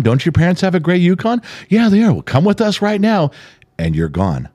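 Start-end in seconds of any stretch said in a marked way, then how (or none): 0.0-3.3 put-on a sweet, much higher voice of a stranger coaxing a child; luring stranger. Mother acts out a stranger tricking her kid, sweetly high. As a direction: play the fake-friendly kidnapper, light and high.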